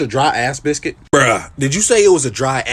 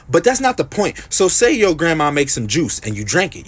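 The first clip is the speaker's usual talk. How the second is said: normal talking voice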